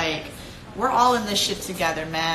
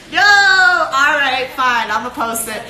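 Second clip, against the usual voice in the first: laughing give-in; 4 semitones higher, 8 dB louder, slower than usual. Real, not acted. Giving in to fans, laughing.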